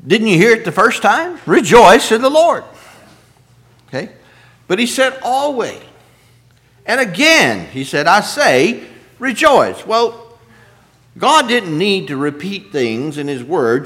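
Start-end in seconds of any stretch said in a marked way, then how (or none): 1.5-2.6 louder, higher, driving it home; emphatic repeat. Acted. Preacher teasing the congregation, loud and emphatic. 5.2-9.8 emphatic quote. Acted. Preacher hammering the verse to the congregation, emphatic.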